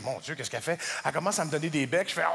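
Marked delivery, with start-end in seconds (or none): 0.0-0.8 low, soft suspicious inner thought; low worried murmur. Retold or imitated. Thinking about his girlfriend in bed, a low worried murmur. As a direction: mutter your worry to yourself.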